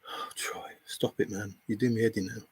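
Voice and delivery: soft, half-whispered exasperation; weary exasperation. Real, not acted. Streamer fed up with a needling viewer, quiet weary exasperation.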